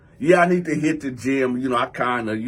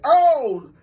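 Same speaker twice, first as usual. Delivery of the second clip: loud, high exclamation; startled "oh"; 8 semitones higher, 6 dB louder than usual. Retold or imitated. Man dodges a thrown jab, loud startled "oh". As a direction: reenact recoiling from a jab.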